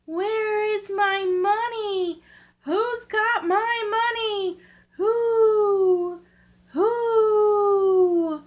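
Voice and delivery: high, slow, crying ghost voice; dead woman's ghost wailing, rising to loud cries of "Who?". A narrator's reading. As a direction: wail from far off for her lost money. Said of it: "a voice crying".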